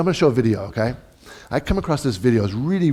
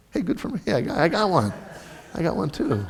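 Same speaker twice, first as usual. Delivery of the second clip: mock self-congratulation; 5 semitones higher, 5 dB quieter than usual.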